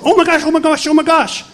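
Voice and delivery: a wife shrieking at a miracle; squealing in disbelief, voice jumping way up; retold excited scream. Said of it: "his wife starts screaming".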